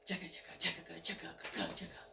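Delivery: soft unvoiced chugga chugga like a steam engine; whispered train chug. Narrator makes the train chug, whispered. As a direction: breathe out the train's chug under your breath.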